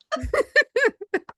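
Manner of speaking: squealing high giggle. Real, not acted. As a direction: burst into high-pitched giggles. Giggle fit.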